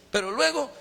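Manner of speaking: put-on old man turned childlike, begging for his lesson; childlike father. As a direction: ask eagerly like an impatient little kid. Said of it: "Como un niño decía".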